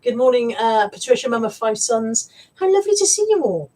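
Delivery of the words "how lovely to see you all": high gushing greeting. Pretend.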